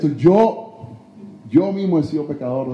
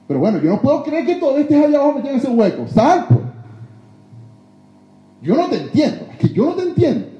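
high, loud, fast mock exasperation; exasperated helper; 9 semitones higher, 4 dB louder, faster than usual. Preacher acting a frustrated helper, loud exasperation. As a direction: throw up your hands at someone stuck in the hole.

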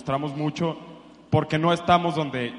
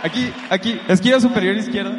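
higher, raised calling voice; 5 semitones higher, 5 dB louder than usual. Real, not acted. Audience member calls out where she sits, raised voice. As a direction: wave him over with your voice.

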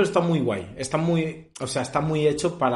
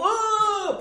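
mock death cry. Acting death scene cry.

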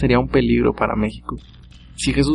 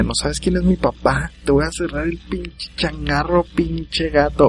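mock spoiled brat threatening, higher pitch; entitled rich kid yell; 4 semitones higher, slower than usual. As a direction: play the daddy's boy who threatens the cop. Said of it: "gritaría".

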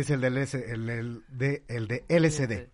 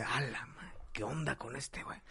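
much softer, muttered aside; muttering crowd; 3 semitones lower, 16 dB quieter than usual. Retold or imitated. Bar patrons mutter about the drunk, very soft. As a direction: grumble under your breath. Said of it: "todos en el bar así de".

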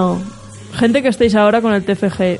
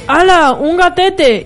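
excited exclaim; 7 semitones higher, much slower than usual. Retold or imitated.

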